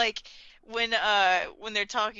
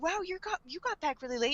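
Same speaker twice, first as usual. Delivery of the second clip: soft, higher-pitched acted voice; mock family greeting; 6 semitones higher, 7 dB quieter, faster than usual. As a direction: imitate a lightly surprised relative. Acting family greeting Light.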